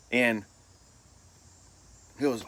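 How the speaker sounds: usual speaking voice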